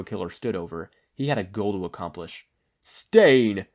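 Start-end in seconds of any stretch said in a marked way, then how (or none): 2.8-3.7 loud furious shout of a name. Acted. Ida confronting the hero killer, loud angry shout. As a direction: call out the killer with rage. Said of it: "he shouted".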